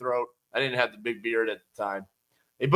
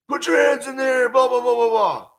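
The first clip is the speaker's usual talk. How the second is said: high, loud shouted threat; retold robber shout; 10 semitones higher, 5 dB louder than usual. Robber yelling at the cashier, loud and high. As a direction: bark it like an armed robber. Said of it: "they start screaming".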